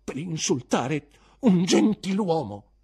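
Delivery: high, loud, slow accusation; wounded teacher's heated rebuke; agitated indignation. Acted. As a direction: press the insult home, deeply offended. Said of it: "le cui labbra tremavano molto".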